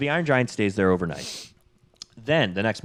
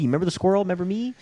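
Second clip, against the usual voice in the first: anxious pleading; 9 semitones higher, much faster than usual. Retold or imitated. Voicing Hogarth pleading to be recognized.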